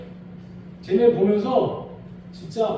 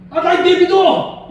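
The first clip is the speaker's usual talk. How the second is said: high, loud, slow whiny yell; mock a stubborn sulker yelling leave me be; stubborn shout; 8 semitones higher, 10 dB louder, much slower than usual. Preacher imitating a stubborn churchgoer, whiny loud yell. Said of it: "이런 사람도 있는데 누가 그러더라".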